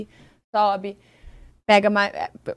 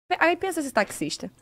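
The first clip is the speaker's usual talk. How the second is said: ordinary delivery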